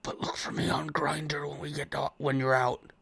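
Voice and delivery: soft, secretive whisper; hushed words to a drifting patient; doctor's whisper. Acted. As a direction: lean in and whisper confidentially. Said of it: "kinda lean down and whisper".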